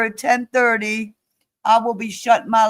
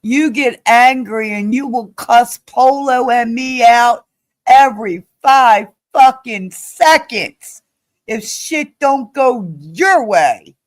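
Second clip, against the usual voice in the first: real heated, punched-out words; emphatic, indignant telling-off; angry accusation; 3 dB louder than usual. Real, not acted. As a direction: hammer each word as you accuse. Accusing Maria in real argument.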